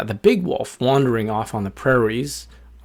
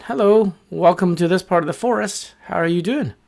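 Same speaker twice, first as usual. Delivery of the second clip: high, cheery little-bird hello; little bird greeting; 6 semitones higher than usual. A narrator's reading. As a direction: greet the newcomers in a higher, friendly voice.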